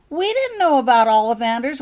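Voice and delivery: loud high wail of dismay. A narrator's reading. Becky wails to her classmates, loud and high. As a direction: wail it out, near tears. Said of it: "wailed Becky".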